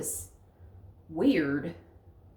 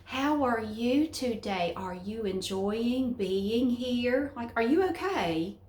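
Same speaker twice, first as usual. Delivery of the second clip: put-on slow, overly careful talk as if to a child; imitating northerners talking slowly to her; mock slow speaking; much slower than usual. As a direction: drag every word out, patronizing. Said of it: "start speaking slowly".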